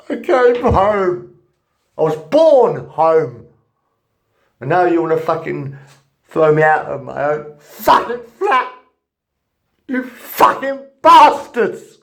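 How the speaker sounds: loud, high, slow angry yelling; furious shouting. Real, not acted. Tenant shouting at the landlord about eviction, furious. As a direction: let the anger explode at them.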